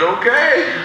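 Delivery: high nervous okay. Retold or imitated.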